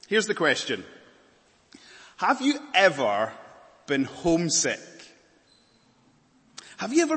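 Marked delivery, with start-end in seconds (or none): none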